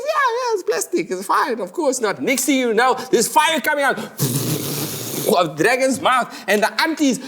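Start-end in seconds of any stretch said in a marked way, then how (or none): 0.1-2.2 very high, fake-easy voice; shifty reassurance. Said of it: "the new kid says". 3.1-7.2 building alarm. Acted. Disaster building at beach.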